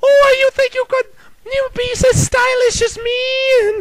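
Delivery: high, fast, haughty diva voice; Miss Piggy impression. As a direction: play the vain pig diva looking down on them. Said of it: "Miss Piggy going".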